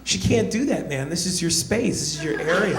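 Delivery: mock outrage on a friend's behalf; offended friend. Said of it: "turn to me offended, like".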